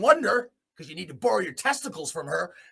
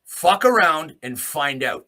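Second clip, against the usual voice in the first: slow, louder, each word hit; slow warning; 4 semitones lower, 4 dB louder, slower than usual. Real, not acted. Warning the Canadian government, slow emphatic threat. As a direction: spell out the threat slowly.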